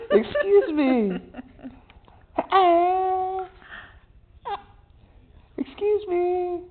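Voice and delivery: slow, high "Excuse me" to teach; slow demo to copy. Shown as a demonstration. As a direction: stretch out "Excuse me" for the baby. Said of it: "You gotta say".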